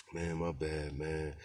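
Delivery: quiet, low-key apology; soft-spoken apology. Retold or imitated. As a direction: mumble it softly, cool and easy. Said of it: "He's soft-spoken".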